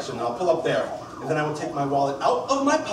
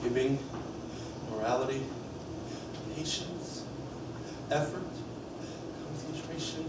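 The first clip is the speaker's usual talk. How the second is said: a much softer, calming recitation; quiet recital; 6 semitones lower, 14 dB quieter than usual. Acted. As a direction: murmur the list to yourself to stay calm. Reciting perfections under his breath.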